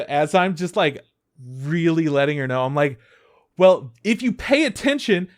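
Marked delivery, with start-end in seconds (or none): 3.6-5.3 loud, high-pitched berating; mock yelling. Teasing a coworker while teaching her, clearly yelling. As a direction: yell insults at a coworker in play. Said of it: "I'm, like, very clearly yelling".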